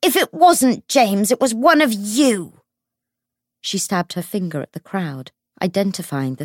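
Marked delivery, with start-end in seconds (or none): 0.0-2.6 wailing accusation. A narrator's reading. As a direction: wail the blame at the whole crowd. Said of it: "she wailed".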